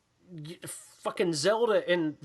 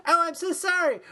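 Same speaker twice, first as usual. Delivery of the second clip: very high-pitched whimpery apology imitation; high put-on voice of someone caught out; mock apology; 12 semitones higher than usual. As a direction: squeal a hammed-up sorry. Acted apology in retold film scene.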